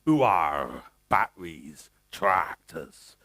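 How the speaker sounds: very low West Country farmer accent. Shown as a demonstration. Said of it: "I start sounding like a farmer".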